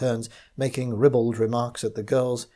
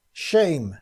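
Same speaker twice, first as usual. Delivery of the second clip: loud, raised shout from the crowd; 5 semitones higher, 4 dB louder than usual. Acted. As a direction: call it out in protest. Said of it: "shouted someone".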